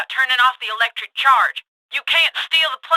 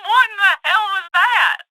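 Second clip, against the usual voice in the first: high, shouted disbelief; startled, high-pitched yell; alarmed outburst; 9 semitones higher, 3 dB louder than usual. Acted. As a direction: demand an answer at a shout. Boss reacts to something shocking.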